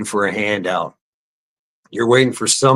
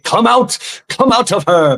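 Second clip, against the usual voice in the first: shouted much higher, casting out; mock exorcist command; 10 semitones higher than usual. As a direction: bark it like an exorcist.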